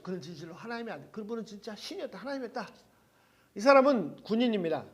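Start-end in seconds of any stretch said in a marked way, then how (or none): 0.0-2.7 much softer, hushed realization; imitating a soldier's quiet amazement; awed confession. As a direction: murmur it, as if it slips out. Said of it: "마가복음 뭐라 그랬죠".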